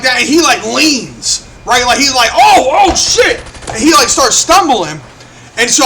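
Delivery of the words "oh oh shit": louder, higher mock alarm; hammed-up startled outburst; retold startled yelp. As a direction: imitate someone caught off balance. Retold kicked boy losing his balance.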